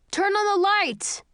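high, loud shouted call. A narrator's reading. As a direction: yell it across the room. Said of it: "someone shouts".

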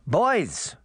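Granny's shout. A narrator's reading. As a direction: call the boys to order, mildly shouted. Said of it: "Granny shouted".

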